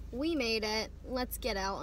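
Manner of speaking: ordinary talk, nothing marked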